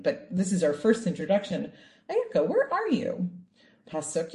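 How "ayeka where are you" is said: much higher, calling out gently; God calling out. Acted. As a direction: call across the garden to Adam. God calling Adam, retold.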